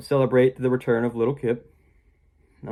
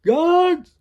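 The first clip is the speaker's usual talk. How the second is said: high, loud alarmed shout; calling the guards; 8 dB louder than usual. Retold or imitated. The king calling his guards on the intruder, a high loud shout. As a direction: yell for the guards. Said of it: "he's like".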